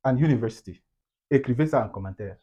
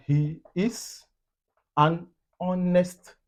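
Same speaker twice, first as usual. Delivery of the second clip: drawn-out, higher "an honest"; slow example; 5 semitones higher, much slower than usual. Shown as a demonstration. Teacher giving learners an example, slow and word by word. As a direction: stretch each word of the example apart.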